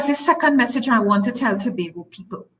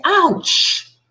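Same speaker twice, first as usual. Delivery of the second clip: sudden ouch; 9 semitones higher than usual. Retold or imitated. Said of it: "and I say".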